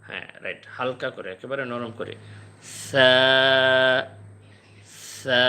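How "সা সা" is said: light soft sa held one second; soft thin sa. Shown as a demonstration. Teacher showing students the soft Arabic sa. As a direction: breathe out a thin gentle sa. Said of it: "এক second টানবো আর হালকা করে".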